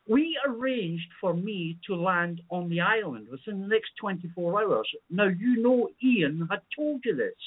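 a lightly put-on English accent for the caller; mock English accent. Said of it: "even your English accent is that fucking poor".

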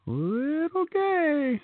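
mock campy voice. Teasing the caller's story.

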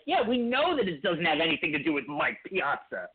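condescending, mocking tone; mocking Jeff Wilpon. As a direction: sneer it like a smug owner. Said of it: "like, mockingly, like, condescending tone".

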